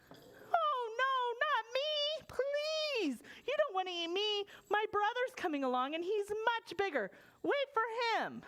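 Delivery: high, begging goat voice; pleading billy goat. Acted. Middle goat pleads with troll.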